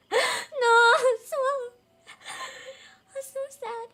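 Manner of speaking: high, loud mock wail; half-joking lament for the bird; sad wail. Real, not acted. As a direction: wail it in grief. Mourning the swallow.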